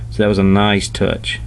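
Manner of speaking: usual speaking voice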